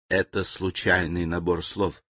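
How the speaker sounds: soft, low and even; calm answer. Acted. Servant calmly explains the machine's fault to the general. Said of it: "спокойно ответил слуга".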